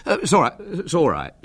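flustered, high-pitched surrender; mildly panicked boy backing down; broken, giving in. A narrator's reading. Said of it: "said William brokenly".

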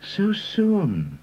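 high-pitched, lightly murmured character line; mild murmur. A narrator's reading. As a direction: murmur it in a high voice, not hushed. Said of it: "murmured Reader".

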